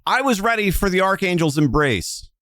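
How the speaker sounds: high, pleading cry to the crowd; impassioned appeal. Acted. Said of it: "turns to the crowd as she says that".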